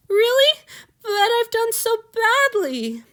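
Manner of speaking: high, slow, teary disbelief; tearful disbelief. Acted. Weeping boy doubting his lord's mercy, high and slow. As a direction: hardly dare believe it.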